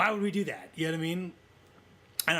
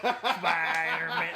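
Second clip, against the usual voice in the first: slow, drawn-out robot voice; robotic voice; much slower than usual. Pretend. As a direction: say it like a monotone robot. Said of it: "in a robotic voice over and over again".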